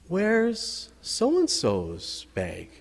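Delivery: put-on slow, high little-boy question; child's question. Boy asks his teacher about the biter's bag, slow and innocent. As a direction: ask it slowly and innocently like a small boy.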